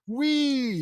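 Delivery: high, loud squeal of glee; gleeful whoop. Acted. Lucy zipping over cubicles, gleeful. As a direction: cry out as if riding a zip line. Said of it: "zipping on a zip line".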